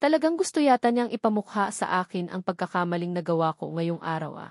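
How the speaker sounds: ordinary delivery, nothing marked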